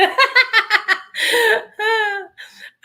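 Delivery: big squealing laugh, loud and high. Real, not acted. Cracking up at own joke.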